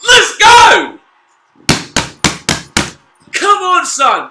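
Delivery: yeses building into full-throated screams; jubilant shouting. Real, not acted. Fan watching Lynch kick a goal, jubilant shouting. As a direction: explode in high, very loud celebration.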